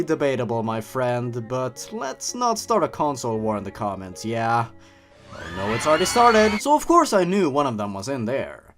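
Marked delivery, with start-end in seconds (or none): none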